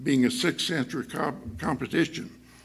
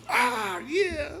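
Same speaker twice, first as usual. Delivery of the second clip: delighted realization; 9 semitones higher than usual. Retold or imitated. Foreign student suddenly understands.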